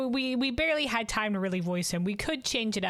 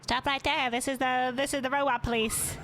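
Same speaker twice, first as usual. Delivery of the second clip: robot cop voice; 6 semitones higher than usual. Acted. Voicing robot police officer.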